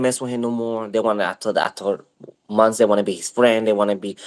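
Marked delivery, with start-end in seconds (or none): none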